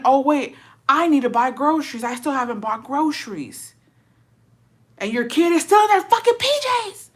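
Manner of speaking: sudden high loud panic; panicked realisation. Acted. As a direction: freak out at forgetting.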